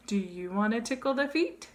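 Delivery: ordinary talk, nothing marked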